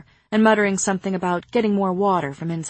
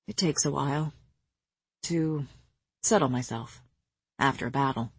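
lower, softer weary murmur; 4 semitones lower, 6 dB quieter than usual. Acted. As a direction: let it sink low and tired. Rhys murmurs in bed, tired.

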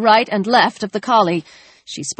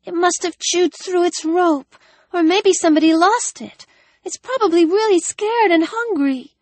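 put-on a child fretting over a lost dog; young girl, worried; 11 semitones higher than usual. A narrator's reading. Narrator voices young Ellie.

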